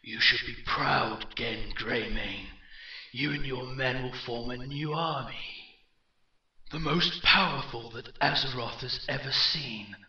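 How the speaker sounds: echoing warlord, building from forceful to grand; rally the new army with swelling force; commanding villain, slowing on the final words; rising in intensity. Acted. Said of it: "his voice rising in intensity".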